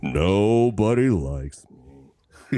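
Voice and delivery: very slow, low mopey donkey; Eeyore voice. Acted. Slow droopy moan. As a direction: guest attempting Eeyore for the hosts, very slow. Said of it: "like Eeyore".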